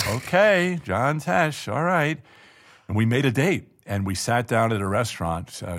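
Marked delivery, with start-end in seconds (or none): 0.1-2.2 high, amused woman's voice with a laugh; a hint of a surprised Connie laughing; imitating Connie. Connie agreeing on the air.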